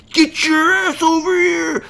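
high, drawn-out mock holler; yelling. Someone chasing the dog down, yelling. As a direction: imitate an owner yelling after a runaway dog. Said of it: "chasing you down and yelling at you".

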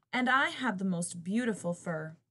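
ordinary delivery

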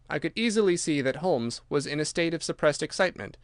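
plain reading voice

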